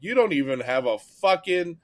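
heated fan comeback at a Canucks fan; retold chirp back. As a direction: rise up and hammer the insult. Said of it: "I'm like".

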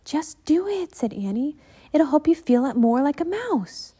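put-on a keen girl coaxing her brother; lively Annie. Annie insisting.

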